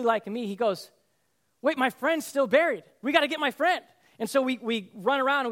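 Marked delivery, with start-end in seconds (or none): 1.6-3.8 higher, louder urgent plea; retold cry of alarm for a friend. Rescued man begging help for his buried friend, urgent alarm.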